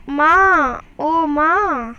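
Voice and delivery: loud call of माँ, ओ माँ, drawn out to reach her. Acted. Sick boy calls his mother loudly. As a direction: call out for mother from across the house. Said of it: "माँ, ये चिल्ला रहा था".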